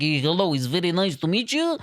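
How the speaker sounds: lightly put-on Eastern European accent. Colleague greeting customers in an Eastern European accent. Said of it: "Eastern European people".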